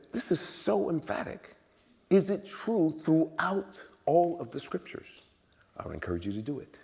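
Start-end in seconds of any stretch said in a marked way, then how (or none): 0.1-5.2 high, drawn-out wonder; astonished self-quote. Retold or imitated. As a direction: recall marvelling aloud, pitched up and slow. Quotes his own amazed reaction.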